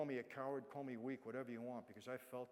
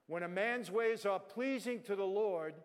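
slow, loud, high proclaimed verse; preacher reading scripture aloud; scripture recitation; 5 semitones higher, 9 dB louder, slower than usual. Acted. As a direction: lay the verse out slowly and loudly. Reciting Proverbs 16:7.

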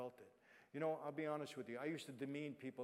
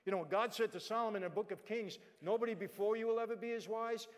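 loud, raised preacher's delivery; preaching; 7 semitones higher, 7 dB louder than usual. Acted. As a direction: proclaim it to the congregation. Preaching the scripture.

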